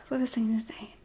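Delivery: unvoiced whisper, lost the thread; whispered to self. Real, not acted. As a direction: breathe it to yourself, forgetful. Muttered to herself, losing track.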